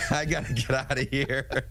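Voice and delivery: cracking up at the co-host's accent; amused laugh breaking through. Real, not acted.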